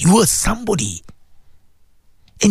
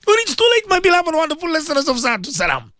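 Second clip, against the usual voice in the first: impassioned high cry; 8 semitones higher than usual. Real, not acted. Climax of warning to listeners.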